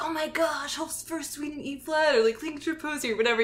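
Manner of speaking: gushing high-pitched fangirl voice; excited band kid. Retold or imitated. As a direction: swoon over the piece like a teen. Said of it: "you're like".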